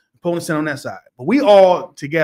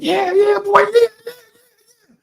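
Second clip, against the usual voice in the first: cheering scream. Retold or imitated. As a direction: scream it out like a proud dad in the stands. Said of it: "I'm screaming".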